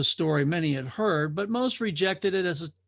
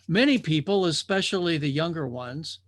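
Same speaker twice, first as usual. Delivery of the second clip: ordinary delivery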